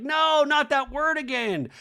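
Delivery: high, drawn-out wail of protest; hammed-up high dread; mock dismay. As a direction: wail it slowly in mock horror. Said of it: "And I was like".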